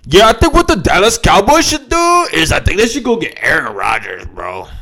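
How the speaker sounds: high, loud dudebro radio caller. Retold or imitated. Mocking a radio call-in guy, hammed-up caller voice. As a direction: ham up a sports radio caller pitching a trade. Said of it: "how people call on the radio".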